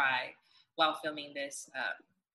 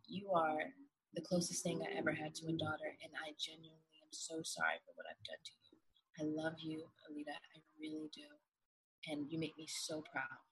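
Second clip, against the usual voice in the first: dying confession; 2 semitones lower, 9 dB quieter than usual. Retold or imitated. Retelling a dying father figure's last words to his daughter, softly. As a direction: deliver it quietly and tenderly, as if with little breath left. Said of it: "his last breath on his deathbed".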